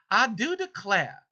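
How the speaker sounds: high, slow Southern belle-style drawl; put-on Southern lilt, pitched high; Southern accent. Shown as a demonstration. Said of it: "I just gotta hear it Southern".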